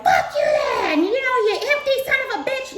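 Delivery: high, loud cursing shout; mock furious yell. Everyone fed up with the empty person, high furious yell. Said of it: "who's like".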